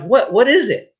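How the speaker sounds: high, puzzled questions; baffled surprise. Retold or imitated. Young recruit asking his envious shipmates what his job is, high and baffled. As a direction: ask like you have no idea what you got.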